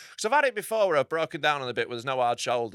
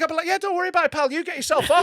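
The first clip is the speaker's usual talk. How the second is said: much higher, louder sarcastic voice; sarcastic mock reassurance; 12 semitones higher, 3 dB louder, faster than usual. Driver to mechanic, sarcastic mock reassurance. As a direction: pretend to let him off, dripping sarcasm. Mock indignant imagined reply.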